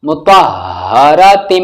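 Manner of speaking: slow "मुतहहरतिम" with a long hummed final m; flowing recitation. Shown as a demonstration. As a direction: stretch it out, closing on a held m. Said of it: "रवानगी से पढ़ते हैं". ("मुतहहरतिम" धीमे, गुन्ने के साथ)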